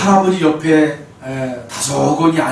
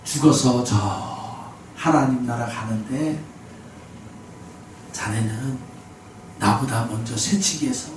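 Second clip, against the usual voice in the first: lower, softer, slow halting speech; very old man gently rebuking the shouter, slow stammering; 5 semitones lower, 9 dB quieter, slower than usual. Retold or imitated. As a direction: hesitate on every word like a frail ninety-year-old. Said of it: "말을 더듬더듬 하시면서".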